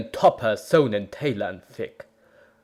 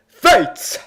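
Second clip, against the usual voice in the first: full-throated scream, much higher and louder; 13 semitones higher, 7 dB louder than usual. Acted. Hero defying fate in battle, all-out scream. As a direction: scream it at the heavens. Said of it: "I screamed into the frozen night".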